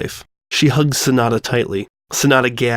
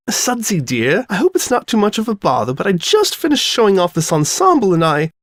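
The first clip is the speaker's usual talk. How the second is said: posh lady voice; 8 semitones higher than usual. Acted. Rarity's posh entrance speech.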